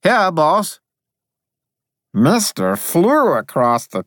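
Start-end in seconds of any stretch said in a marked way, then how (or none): 0.0-0.8 high, bright little spirit voice; high piping voice from the wall. Acted. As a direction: chirp it from inside the stone.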